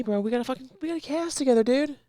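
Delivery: high-pitched, hyped-up bragging; high excited bragging. Real, not acted. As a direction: brag about the cast, voice way up.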